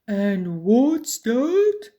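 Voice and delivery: a puzzled troll; troll wondering aloud; slow troll question. A narrator's reading. Troll asking.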